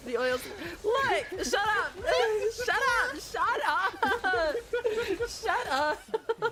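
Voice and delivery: high, loud laugh-yelling Shut up; laughing so hard the words crack; hysterical laughing shouts. Real, not acted. Embarrassed streamer yelling shut up at teasing friends, laughing.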